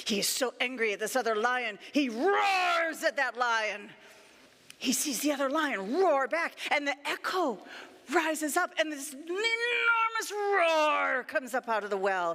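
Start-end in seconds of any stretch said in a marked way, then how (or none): 2.1-3.0 "roars" stretched long and slow; drawn-out ROARS. A narrator's reading. Storyteller telling of the lion, "roars" drawn out long. 9.1-11.2 "enormous roar" stretched long and rolling; roaring emphasis. A narrator's reading. Storyteller describing the echo from the well, "enormous roar" drawn out.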